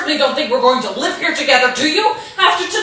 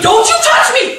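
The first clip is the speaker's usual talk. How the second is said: full angry shout, pitched higher; 9 semitones higher, 6 dB louder than usual. Acted. Wife yelling at her husband to keep away, shouting. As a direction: scream it at him to back off.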